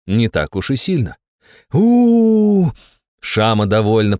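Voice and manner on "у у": loud, high drawn-out whoop; exhilarated whoop. A narrator's reading. Driver thrilled by going off-road, whooping.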